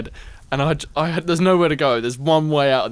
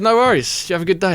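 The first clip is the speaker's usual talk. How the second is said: cheery reply; 7 semitones higher, 4 dB louder, faster than usual. Retold or imitated. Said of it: "And I said".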